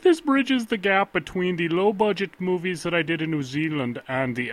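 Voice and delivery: slow New Zealand accent impression. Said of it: "my New Zealand accent".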